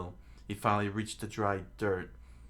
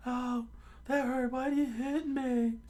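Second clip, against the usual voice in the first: very high falsetto whine; high, put-on protest; hurt complainer; 4 dB louder than usual. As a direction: whine about it in a squeaky cartoon voice. Usopp complains after being hit.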